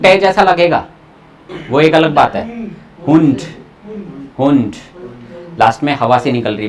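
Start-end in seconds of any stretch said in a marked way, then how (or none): none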